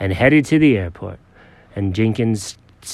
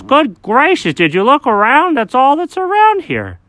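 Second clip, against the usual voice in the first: lightly voiced dog, exasperated; 13 semitones higher, 4 dB louder than usual. Alfred voiced higher.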